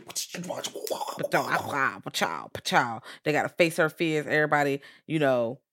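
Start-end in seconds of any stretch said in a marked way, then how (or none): 0.0-3.0 playful pew-pew blast noises; mock blast sounds. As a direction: fire off imaginary power blasts. Mimicking superpower blasts.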